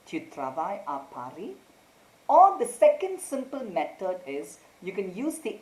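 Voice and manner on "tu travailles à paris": "Tu travailles à Paris?" rising on Paris as a question; questioning intonation. Shown as a demonstration. Teacher shows students the tone change, questioning rise on "Paris". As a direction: turn "Tu travailles à Paris?" into a question, lifting Paris at the end. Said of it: "change your tone and put a question mark".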